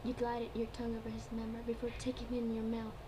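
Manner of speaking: usual speaking voice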